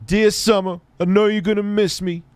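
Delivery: high, hammed-up farewell; mock sentimental. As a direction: recite like a sappy letter.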